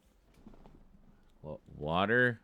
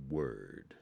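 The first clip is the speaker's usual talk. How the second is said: low, soft, laid-back "Word"; understated mock-hip "Word"; cool guy "word"; 7 semitones lower, 6 dB quieter than usual. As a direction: drop "Word" low and slow like a chill dude. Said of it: "saying word out loud and folding my arms".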